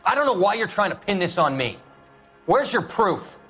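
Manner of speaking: indignant denial. Acted. Accused man challenging the detective who pins it on him, indignant.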